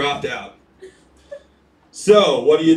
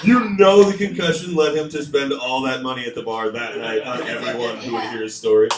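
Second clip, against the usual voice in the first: ordinary talk, nothing marked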